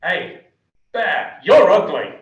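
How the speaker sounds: loud jeering yell across the bar, drawn out slowly; shout. Retold or imitated. Hidden heckler yelling at the bear in the bar, loud slow jeer. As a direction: bellow the insult across the room. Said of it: "this shout comes across the bar".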